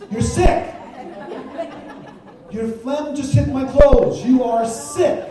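loud, laughing exasperation; exasperated loud retort. Real, not acted. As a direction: blurt it out half laughing.

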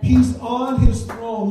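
loud, slow proclamation; preaching shout. Real, not acted. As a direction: declare it slowly and loudly.

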